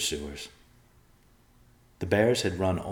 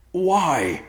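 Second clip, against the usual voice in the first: much higher, much louder anguished why; cried out; 13 semitones higher, 8 dB louder than usual. A narrator's reading. Son cries out at his father in anguish. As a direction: cry out in disbelief. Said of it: "Riverpaw cried".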